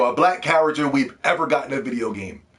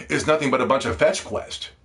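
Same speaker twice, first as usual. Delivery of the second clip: ordinary talk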